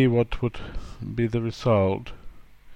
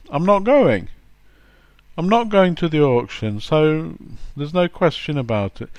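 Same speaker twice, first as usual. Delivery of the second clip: higher, louder flat refusal; blunt refusal; 4 semitones higher, 3 dB louder than usual. Retold or imitated. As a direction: shut the question down firmly. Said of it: "What does the person mean?".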